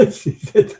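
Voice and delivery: high, breathless laughter breaking through; can barely get the words out for laughing; laughing hard. Real, not acted. Retelling a funny chat moment to viewers, real laughter.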